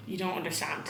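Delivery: normal talking voice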